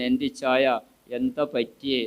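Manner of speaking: soft caring question; gently. Shown as a demonstration. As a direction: ask the late husband with quiet concern. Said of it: "സൗമ്യമായിട്ട്".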